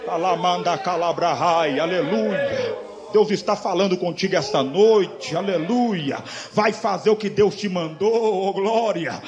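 loud, drawn-out exhortation building to a shout; fervent Pentecostal preaching. Real, not acted. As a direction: proclaim it forcefully, stretching the key words. (pregação pentecostal fervorosa, em voz alta)

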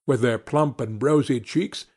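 ordinary delivery

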